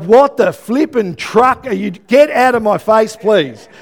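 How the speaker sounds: loud, slow snap of a rebuff; mock-furious teenager telling her to back off; angry retort. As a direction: bite her head off. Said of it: "But I was like".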